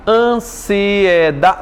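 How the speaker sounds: "Ansiedade" very slow, in separate syllables. Shown as a demonstration. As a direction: stretch out each syllable of "Ansiedade". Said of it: "vamos analisar".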